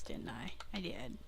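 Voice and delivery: whispered to herself; barely voiced, under the breath; whispered aside. Real, not acted. Muttering to herself mid-game, whispered.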